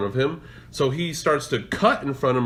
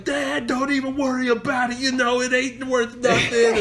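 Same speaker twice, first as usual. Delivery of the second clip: high-pitched retold plea to dad; calling dad off; 9 semitones higher than usual. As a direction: call out to hold dad back. Calls to angry dad in story.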